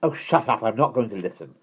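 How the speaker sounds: put-on upset child's retort; protesting child. A narrator's reading. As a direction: shut the teasing down crossly. Teased sister protests in story.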